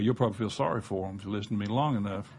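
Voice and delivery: ordinary talk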